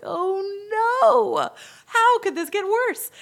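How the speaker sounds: high, loud, drawn-out mock wail; dismay. Storyteller replaying her reaction to the client, mock dismay.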